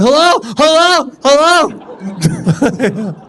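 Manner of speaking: high, loud hello over and over; frantic radio calling. Acted. Panicked passenger calling for anyone on the radio, frantic.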